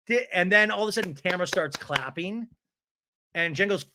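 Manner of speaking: ordinary delivery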